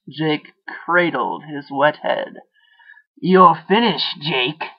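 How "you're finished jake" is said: taunting inner voice. A narrator's reading. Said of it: "the voice continued".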